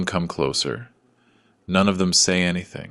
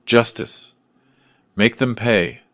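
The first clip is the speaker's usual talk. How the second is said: higher, moderately raised yell; crowd yell; 4 semitones higher, 4 dB louder than usual. A narrator's reading. Crowd members as the children are taken away, yelling. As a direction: call it out like someone in a crowd.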